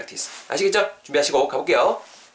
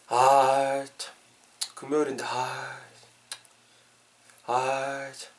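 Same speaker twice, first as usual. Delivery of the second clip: bored sighing; 4 dB quieter, much slower than usual. Acted. Teacher acting out boredom on a Friday, bored sighing. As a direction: sigh it out softly, idle and bored.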